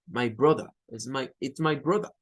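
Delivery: "brother" ending in a schwa, no R; non-rhotic British. Shown as a demonstration. Teacher modelling British family words for students. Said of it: "sino que dice brother".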